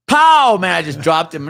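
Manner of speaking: high, loud burst of triumph; excited shout. Retold or imitated. Cop reliving dropping the man with a taser, high excited shout. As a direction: yell it like the taser just landed.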